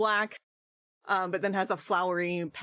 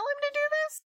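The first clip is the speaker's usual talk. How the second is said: put-on old prospector; much faster than usual. [name] in character, rising alarm.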